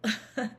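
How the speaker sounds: brief laugh at her own silly word; little giggle after the mispronunciation; short amused chuckle. Real, not acted.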